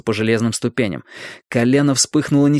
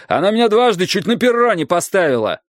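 indignant; 8 semitones higher, 3 dB louder than usual. A narrator's reading. Strike protests angrily.

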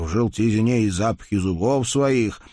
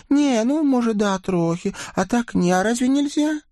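put-on high, lively girl's voice; girlish young woman voice; 14 semitones higher than usual. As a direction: play a coy village girl. Girl's coy reply.

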